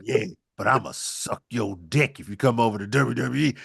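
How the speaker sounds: low seductive put-on voice; sultry mock Sasha. Host voicing Sasha luring Okada to WWE, low and sultry. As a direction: purr the offer low and sly. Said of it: "she gonna be like".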